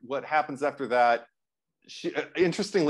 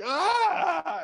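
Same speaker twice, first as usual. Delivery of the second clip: high, sudden mock yelp; mock pained yell. Freddy stabbed by Lisa, a high mock yelp. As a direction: yelp like a monster that barely felt the stab. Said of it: "He's like".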